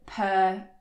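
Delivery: ordinary talk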